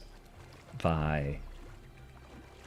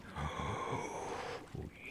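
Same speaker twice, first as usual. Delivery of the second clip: soft, breathless, playful gasp of delight; mock gasp; 2 semitones lower, 11 dB quieter than usual. Teasing patient fans about a new installment, soft mock gasp. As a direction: gasp as if the news thrills you.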